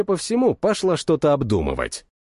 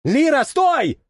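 shouting; 11 semitones higher, slower than usual. A narrator's reading. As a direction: scream her name to stop her. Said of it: "успел заорать".

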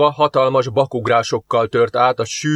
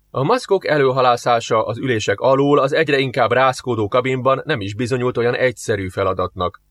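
plain reading voice

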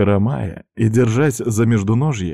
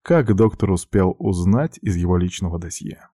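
plain reading voice

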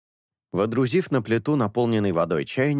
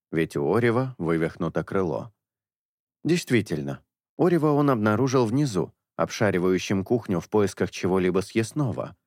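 ordinary delivery

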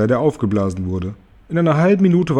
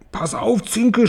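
high, urgent warning without added volume; high-pitched alarm, held back in volume; cried warning; 10 semitones higher, slower than usual. A narrator's reading. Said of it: "schrie Tante Schwamm".

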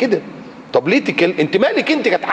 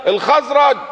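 high, slow call to the clan; drawn-out battle call; 8 semitones higher, slower than usual. Retold or imitated. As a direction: summon your tribe. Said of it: "سنان بن وبر قال".

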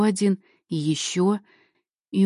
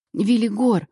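higher, louder call of the name; anguished call across the void; desperate cry; 4 semitones higher, 5 dB louder than usual. A narrator's reading. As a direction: call out with all remaining strength. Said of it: "выкрикнула я, вкладывая в имя весь остаток силы".

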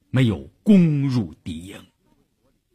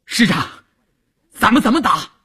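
hurried, eager question; 9 semitones higher than usual. A narrator's reading. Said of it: "就匆匆地迎上来说".

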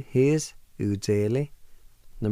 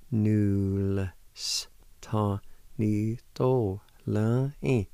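"Null s ta ni do la i" drawn out syllable by syllable; slow demonstration; much slower than usual. Shown as a demonstration. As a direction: stretch every sound slowly. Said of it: "like this".

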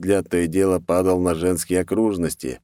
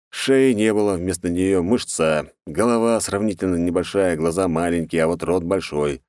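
usual speaking voice

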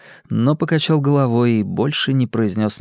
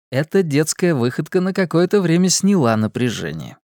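plain reading voice

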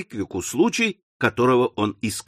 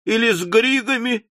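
exclaiming; 7 semitones higher than usual. Acted. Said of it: "воскликнула мисс Напкинс".

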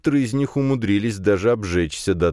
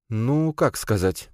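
slow, higher, hesitant reply; noncommittal; 5 semitones higher, much slower than usual. A narrator's reading. Girl on park bench dodging a question, noncommittal. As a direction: draw it out, avoiding a straight answer. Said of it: "неоднозначно ответила девушка".

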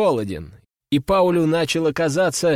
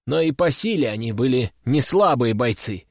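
ordinary delivery

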